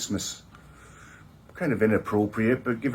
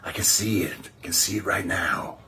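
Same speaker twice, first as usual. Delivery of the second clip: soft, awed whisper; dreamy whisper; 5 semitones lower, 5 dB quieter than usual. Acted. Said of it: "pinnacle of achievement I'm aiming for".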